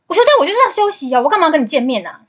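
hammed-up irritated refusal, rushing the words; imitating a fierce boyfriend. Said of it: "就这么凶欸". (模仿男友又快又凶地拒绝)

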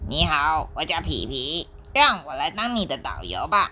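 a hint of a kind penguin; friendly penguin. Penguin offering to guide the monkey, lightly friendly. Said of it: "热情地说".

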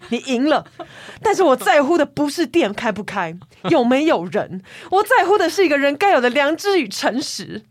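a hurt middle-aged boss venting in melodrama; indignant boss's letter. Retold or imitated. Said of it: "接下来还有更多情绪用语".

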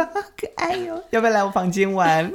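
high cooing tease, then back down; mock flirty.

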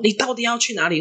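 high, urgent demanding question; acted-out pressing interrogation; retold exasperated father. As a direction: play a dad at his wits' end. Father demands again where child goes.

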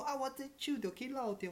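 put-on high, slow old lady voice; mock grandma. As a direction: mimic granny dragging it out. Quotes grandma again. (高音慢慢学阿嬷)

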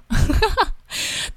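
high breathy embarrassed giggle; a short airy laugh at herself. Real, not acted. Embarrassed laugh at own childishness.

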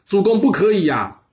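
loud, high cry of alarm; urgent protest. Acted. Courtiers cry out to stop their lord, loud and high. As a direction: throw yourselves in front of the ruler.

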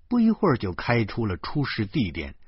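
ordinary delivery, nothing marked

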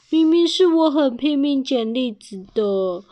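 slightly high, put-on complaint; a touch of sulky protest; mild aggrieved whine. Bear grumbling on the side, mildly aggrieved.

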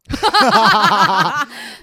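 high, loud shriek of a laugh; real howling laugh; loud burst of laughter. Real, not acted. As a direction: crack up hard and loud.

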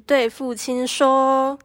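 ordinary talk, nothing marked

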